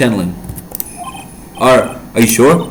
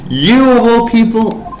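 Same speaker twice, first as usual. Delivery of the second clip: high, slow, laughing scoff; laughing disbelief; 10 semitones higher, slower than usual. Acted. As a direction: laugh at the unlikely claim.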